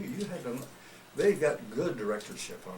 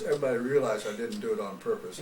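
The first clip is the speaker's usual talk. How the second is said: ordinary delivery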